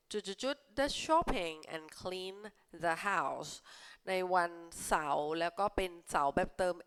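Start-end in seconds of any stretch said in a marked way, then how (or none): 0.8-3.5 slow, careful read-aloud; slow clear classroom read. Shown as a demonstration. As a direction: read each word slowly for learners. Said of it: "reads sentence slowly".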